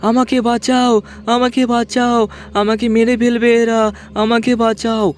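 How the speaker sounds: high-pitched plea from the next room; high, pleading cry of a haunting voice; crying child calling for help. A narrator's reading. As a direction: call out high, begging to be saved. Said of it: "কে যেন পাশের room থেকে ডাকছে".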